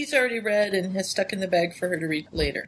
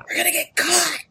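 panicked; 10 semitones higher, 4 dB louder than usual. Retold or imitated. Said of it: "And he's like".

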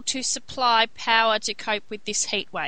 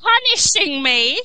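mock outraged shout; 9 semitones higher, 5 dB louder than usual. Ranting about government blackouts.

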